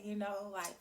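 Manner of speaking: ordinary talk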